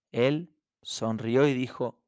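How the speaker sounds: ordinary talk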